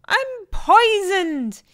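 high, drawn-out howl of a poisoned dingo; high-pitched storybook howl; howling in alarm. A narrator's reading. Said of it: "he howled".

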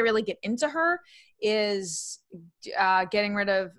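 normal talking voice

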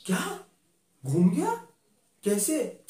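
high, sharp disbelieving question; can't believe what she hears; gasping what?; shocked disbelief. Acted. Friend says necklace is lost.

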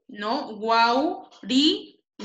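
guau, ri, na spoken as separate beats; syllable by syllable. Shown as a demonstration. Teacher correcting a student's syllable split, broken into beats. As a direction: break the word into guau, ri, na. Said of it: "Vocal cerrada, vocal abierta, vocal cerrada".

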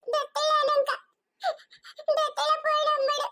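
put-on a small girl begging to be taken to grandma; high, whimpering child voice; lost little girl.

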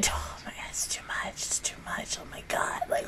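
hushed breathless whisper; overwhelmed whisper. Real, not acted. Fan whispering, overwhelmed by the characters. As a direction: whisper it like it's too much.

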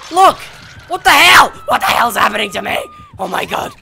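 fast, high, loud real shouts of alarm; panicked yelling. Real, not acted. Gamer shocked by what happens to his character, panicked yelling. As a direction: yell in shock as it goes wrong. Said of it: "for screaming and yelling".

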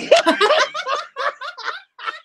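high shrieking laugh after a burp; high burst of laughter. Real, not acted. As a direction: crack up helplessly. Laughing after burping on camera.

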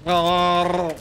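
llama noise. Retold or imitated. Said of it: "He's like".

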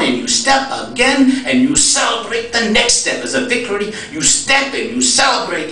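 put-on high, rhythmic Ethiopian-accented preaching, settling at the end; German de Sasa's accent. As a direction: preach step by step as an Ethiopian friend. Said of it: "I do a pretty good German accent".

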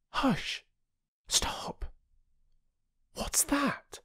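sharp, high whispered alarm; urgent whisper. A narrator's reading. Girl stopping her siblings at a noise, whispered. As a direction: hush the others, something's there.

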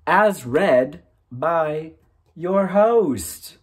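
hammed-up movie-trailer host voice; mock announcer. Vlogger opening his audiobook reading as a grand announcer.

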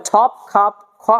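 ঠপ, খাপ with a puff of air on each first consonant; breathy release opening ঠপ and খাপ; aspirated T and K. Shown as a demonstration. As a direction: hit ঠপ and খাপ with strongly aspirated th and kh. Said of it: "টকে ঠ পড়তে হবে".

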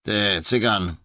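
calling out. Acted. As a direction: hail the man across the tape. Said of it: "zawołał do niego Głód".

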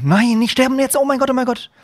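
high, loud panicky outcry; panicked. Real, not acted. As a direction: plead not to die as shots land. Under fire, fears dying in game.

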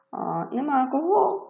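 ordinary delivery

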